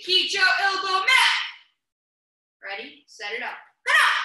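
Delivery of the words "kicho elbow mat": loud, higher shouted count for the class; demo class yell. Shown as a demonstration. Instructor models a loud kiai to kids, shouting. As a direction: shout it out as the example to copy.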